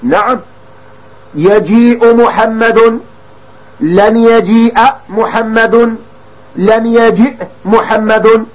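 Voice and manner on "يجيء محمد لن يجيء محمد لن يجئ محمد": يجيء and لن يجيء said very slowly, the final vowel of يجيء sounded out; slow textbook demo of verb endings on يجيء; clearly voiced endings. Shown as a demonstration. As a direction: stretch يجيء محمد then لن يجيء محمد, marking each ending. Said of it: "هكذا تلفظنا بالعلامة".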